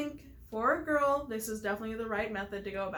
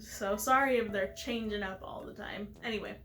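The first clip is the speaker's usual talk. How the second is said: ordinary talk, nothing marked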